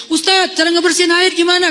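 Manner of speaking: high-pitched mock yell through a door; mock calling out. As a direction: call out an awkward question, pitched high. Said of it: "Nggak enak nanyanya sambil teriak-teriak".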